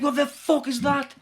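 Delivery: high, loud, drawn-out disbelief; shocked mum. Retold or imitated. Mum seeing the flag her son shows her, shocked. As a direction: act out a mum's outraged shock. Mum's shocked reaction, acted.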